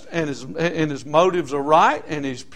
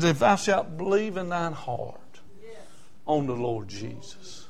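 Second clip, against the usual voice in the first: scripture reading; 3 semitones lower, 7 dB quieter than usual. Acted. Reads Romans slowly.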